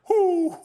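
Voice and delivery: high loud hooting call; owl hoot call. Retold or imitated. Dad calling to the owl off the dock, a high hoot. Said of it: "we hear".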